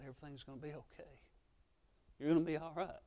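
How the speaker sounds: soft, quick comfort to a hurt friend; reassuring. Acted. Jesus comforting a friend who fell, softly. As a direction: gently soothe the fallen child.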